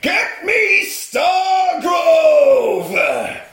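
mock villain yell; villain shout. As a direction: bark the order like a film villain. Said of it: "he says".

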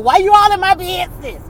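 high, loud mock protest; retold annoyance. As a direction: act out being mad at a nosy mother. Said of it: "I used to get mad".

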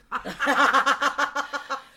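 loud real laugh; big hearty laugh; burst of laughter. Real, not acted. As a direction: laugh out loud at your own joke. After own joke about illness.

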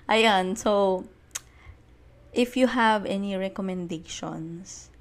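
normal talking voice

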